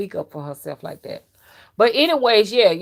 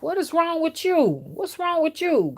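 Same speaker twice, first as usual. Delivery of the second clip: imitating dad; 10 semitones higher than usual. Dad questioning his crying child, imitated in a high voice. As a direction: mimic a father demanding an explanation. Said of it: "'Cause that's how he talked".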